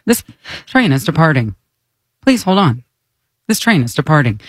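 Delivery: recorded train voice; monotone train announcer. Acted. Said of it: "She's so monotone".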